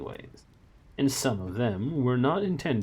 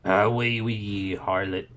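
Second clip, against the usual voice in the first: Scottish Shadwell; 5 dB louder, slower than usual. A narrator's reading. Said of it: "said Shadwell automatically".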